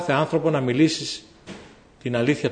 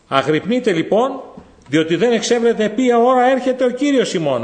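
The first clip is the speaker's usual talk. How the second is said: scripture read out; 8 semitones higher, 4 dB louder than usual. Real, not acted. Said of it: "Και λέει στη συνέχεια".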